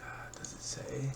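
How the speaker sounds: very soft, half-whispered aside; muttering to self. Real, not acted. Host checking the rankings to himself, muttering very softly. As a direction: murmur it under your breath.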